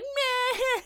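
high-pitched mock bawl; drawn-out hammed-up crying noise; imitated crying wail. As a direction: mimic a dramatic crier wailing.

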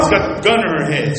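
ordinary talk, nothing marked

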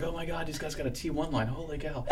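soft, breathy awe at a T1 line; quietly stunned and impressed; amazed whisper. Retold or imitated. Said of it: "I remember I was like".

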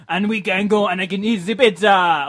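put-on loud, high caricature French accent; over-the-top French accent. [name] getting carried away on the phone, hammed-up French accent. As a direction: let the French accent run gleefully wild. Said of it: "I'm still managing the French accent".

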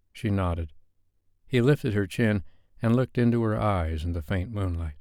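plain reading voice

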